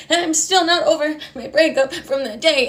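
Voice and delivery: high, broken imitation of sobbing; mock crying. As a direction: blubber it in hammed-up tears. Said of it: "I'll start crying about things".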